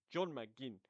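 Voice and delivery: badly faked Scottish accent on the name; terrible Scottish accent. Podcaster naming a Scottish goalscorer in a terrible Scottish accent. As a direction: try a poor Scottish accent. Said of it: "that was a terrible Scottish accent".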